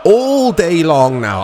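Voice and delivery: normal talking voice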